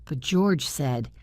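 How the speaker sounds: plain reading voice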